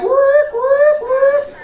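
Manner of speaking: high whooping siren sound; siren imitation. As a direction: imitate an alarm. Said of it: "the warning signs".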